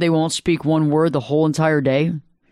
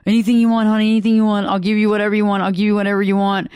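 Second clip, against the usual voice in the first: caving mom; 2 semitones higher, much faster than usual. Retold or imitated. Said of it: "half the moms are over there going".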